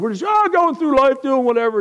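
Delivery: mocking carefree. Mocking careless going through life.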